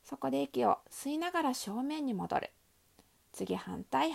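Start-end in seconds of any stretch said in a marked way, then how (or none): 3.3-4.1 whispered quick cue; whispering. Real, not acted. Quick whispered cue between reps.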